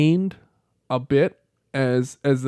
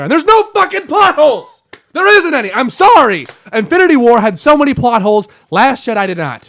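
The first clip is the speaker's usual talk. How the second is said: much higher, much louder angry rant; losing his cool; 10 semitones higher, 8 dB louder than usual. Real, not acted. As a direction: shout it, furious at the critics. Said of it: "I lost my cool about Star Wars".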